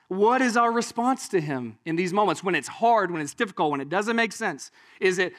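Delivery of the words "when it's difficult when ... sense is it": ordinary delivery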